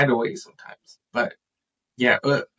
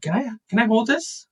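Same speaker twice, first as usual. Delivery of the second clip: put-on higher-pitched little kid voice; imitated child asking; 9 semitones higher than usual. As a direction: mimic a child asking permission. Father voices his young child.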